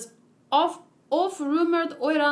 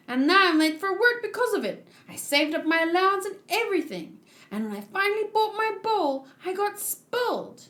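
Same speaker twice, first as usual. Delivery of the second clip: lightly voiced little girl, bubbly; a hint of an excited kid; girlish voice, a little higher; cheerful child voice; 7 semitones higher than usual. Voicing the child Toko.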